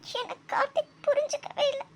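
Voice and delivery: high, slow tearful complaint; acted crying voice, pitched high and drawn out. Hurt Sakthi complaining about Karthik, tearful. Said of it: "சக்தி, ஏன் அழுகுற?".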